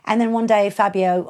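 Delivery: ordinary talk, nothing marked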